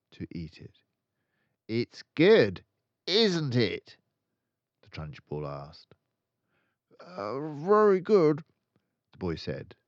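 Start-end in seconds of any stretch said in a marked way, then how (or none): 1.7-4.0 loud, higher, mock-sweet bully; menacing question. A narrator's reading. Trunchbull taunting boy. 7.0-8.4 put-on high, slow, timid child voice; boy nervously praising the cake. A narrator's reading.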